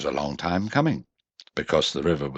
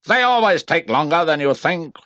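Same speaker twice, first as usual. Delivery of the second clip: higher, brisk know-all voice; Rabbit's voice; 11 semitones higher, 5 dB louder than usual. A narrator's reading. Rabbit telling the others to wait, brisk voice. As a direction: settle them like someone who knows best. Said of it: "said Rabbit".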